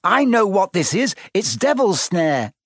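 high, insistent warning; urgent order. A narrator's reading. Hermione recognising the plant, urgently ordering. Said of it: "Hermione ordered them".

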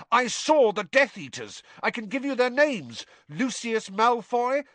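put-on high, insistent boy voice; Harry naming Death Eaters, insistent; urgent Harry. A narrator's reading. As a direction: press the names on him.

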